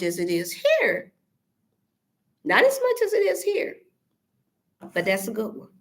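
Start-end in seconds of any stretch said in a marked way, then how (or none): 2.4-3.8 much higher pitch, stressing as; pitched high, insisting; emphatic repeat. Real, not acted. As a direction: lift it way up for emphasis.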